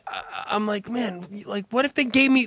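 ordinary talk

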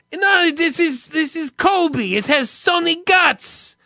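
high, loud, slow put-on clerk accent; movie electronics clerk voice. Comedian quotes the Zohan store clerk in a high, loud voice. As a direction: play the pushy shop clerk from the movie. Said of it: "that's the exact voice I heard".

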